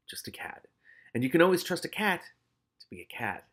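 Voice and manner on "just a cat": breathy whisper of the last words; whispered narration. A narrator's reading. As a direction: hush it down to a whisper.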